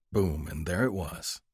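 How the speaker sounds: ordinary delivery, nothing marked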